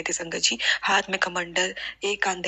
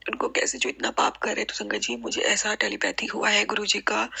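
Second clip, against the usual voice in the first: ordinary talk, nothing marked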